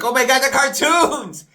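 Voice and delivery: high, loud thrilled cry; excited kid. Retold or imitated. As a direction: squeal it like a kid at dawn. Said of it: "I'll be like".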